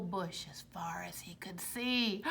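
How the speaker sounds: hushed unvoiced whisper. A narrator's reading. Telling kids the trick, hushed whisper. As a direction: whisper the twist. Suspense reveal.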